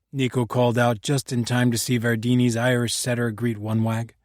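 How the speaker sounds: usual speaking voice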